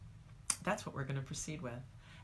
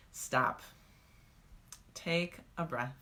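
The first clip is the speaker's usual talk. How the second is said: guided meditation cue; slower than usual. Shown as a demonstration. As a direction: lead them into the pause gently. Leads the stop meditation.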